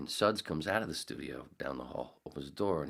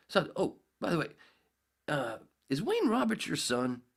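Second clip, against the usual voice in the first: lightly voiced sales manager; 13 semitones higher, 3 dB louder than usual.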